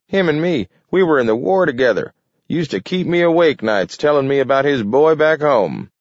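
put-on high chattering old-timer; lively old man reminiscing. A narrator's reading.

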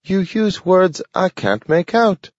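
a confused little dog asking for help; pleading dog. A narrator's reading. Dog Nathaniel pleading with Grant, high and puzzled. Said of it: "he pleaded".

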